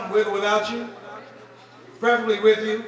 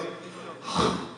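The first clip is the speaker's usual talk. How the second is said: disapproving sigh; 6 dB quieter than usual. Retold or imitated. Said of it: "this is the noise he makes".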